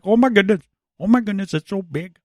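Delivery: high, hammed-up Indian accent. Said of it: "can you do Indian accent".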